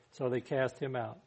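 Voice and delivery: normal talking voice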